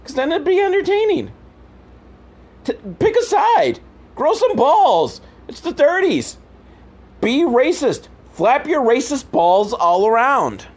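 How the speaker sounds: high, mocking heckler voice; mock pushy voice. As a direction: jeer at the film in a high put-on voice. Mocking voice urging the film.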